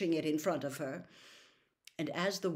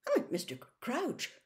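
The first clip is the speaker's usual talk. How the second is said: higher, stammering, flustered denial; horrified; 8 semitones higher than usual. A narrator's reading. Official placates his senior colleague, horrified and stammering. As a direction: backpedal anxiously. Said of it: "Amos Diggory looked horrified".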